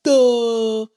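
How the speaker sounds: mocking a slow-witted policeman; dumb cop. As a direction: play the dim-witted cop. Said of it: "this stupid-ass cop".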